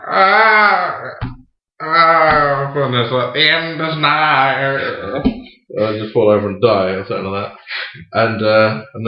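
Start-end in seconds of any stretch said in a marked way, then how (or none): 0.1-2.7 long, loud hammed-up "ah" of dying; drawn-out theatrical dying groan, pitched up; mock death cry. Actor re-enacting his stage death, mock dying cry. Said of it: "on my first death, I'm like". 3.3-5.2 slow, drawn-out, higher mock doom; hammed-up dying words. Actor replaying his death scene, slow mock-dying proclamation.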